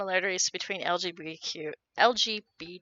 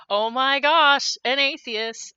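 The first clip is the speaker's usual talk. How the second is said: mock shock; 4 semitones higher, 5 dB louder, slower than usual. Sarcastic mock shock at atheists.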